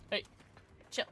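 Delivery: normal talking voice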